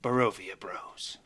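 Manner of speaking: dramatic closing, whispered title; previously on recap. Acted. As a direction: build up then whisper the name. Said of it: "Give it to us, [name]".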